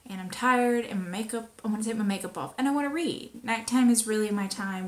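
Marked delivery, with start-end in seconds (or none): none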